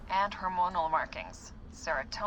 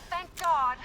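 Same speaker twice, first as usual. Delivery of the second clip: relieved exclamation; 7 semitones higher, 6 dB louder than usual. Acted. Relieved at finding her.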